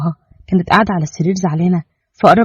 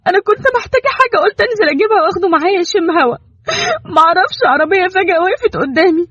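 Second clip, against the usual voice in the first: much higher, weeping pleas; in tears; 12 semitones higher than usual. Acted. Mother begging Kenan through tears to bring back their son. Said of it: "وقالت بدموع".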